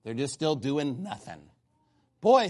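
usual speaking voice

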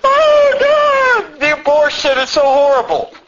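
very high, loud crying shout; hammed-up weeping Baptist; mock Baptist wail. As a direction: parody a hypocrite wailing to God. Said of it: "all these Baptists".

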